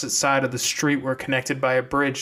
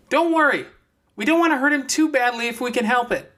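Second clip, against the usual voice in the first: louder, higher call back; 11 semitones higher, 3 dB louder, faster than usual. Acted. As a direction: shout back over your shoulder. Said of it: "call back at a higher volume".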